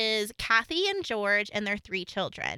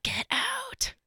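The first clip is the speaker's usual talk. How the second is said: high, eerie ghostly wail; 14 semitones higher, 3 dB quieter than usual. Acted. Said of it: "Do, do your ghost voice".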